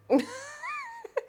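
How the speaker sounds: a squealing high laugh of disbelief; high delighted laugh. Real, not acted. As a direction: let out a giddy high laugh. Laughing at seeing herself.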